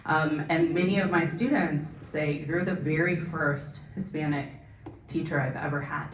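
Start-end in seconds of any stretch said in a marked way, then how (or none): none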